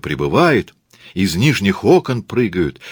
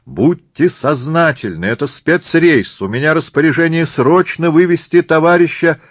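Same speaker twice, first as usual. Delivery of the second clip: high, raised voice appealing to a crowd; loud appeal; 9 semitones higher, 4 dB louder than usual. Acted. Murat blocks the helicopter from tourists, appealing loudly. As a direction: plead over the crowd's noise. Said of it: "взывает к лучшим чувствам".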